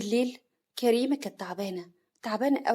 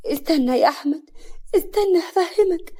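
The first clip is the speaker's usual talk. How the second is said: pained, tearful pleading; 8 semitones higher, 6 dB louder than usual. A narrator's reading. Beaten wife begging husband to listen, tearful and pained. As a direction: beg him to stay through pain and tears. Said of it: "كريمة بألم ودموع".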